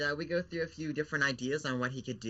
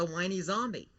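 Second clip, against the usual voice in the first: ordinary talk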